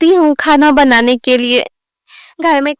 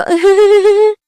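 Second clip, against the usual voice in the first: a loud, high knowing giggle; that special laugh; 6 semitones higher, 5 dB louder than usual. Acted. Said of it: "तो ये वो वाली हंसी है". (वो खास वाली ऊँची हँसी)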